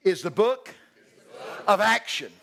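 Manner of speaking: loud, drawn-out preacher call with pauses; call-and-response line. Shown as a demonstration. Preacher leading the congregation in a loud call. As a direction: lead the church to repeat it. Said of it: "Say this with me".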